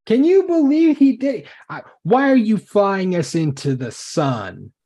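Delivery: very loud, high-pitched outrage; outraged shout. Acted. Character confronting the pilot over flying into the sun, outraged shout. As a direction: yell it in disbelief. Accuser on screen confronts Samathan.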